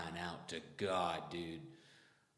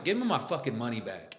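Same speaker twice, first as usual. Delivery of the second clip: heated, louder demand for his money; retold angry demand; 4 semitones higher, 7 dB louder than usual. To the clinic doctor who did nothing, an angry demand. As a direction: snap back at the doctor, fed up.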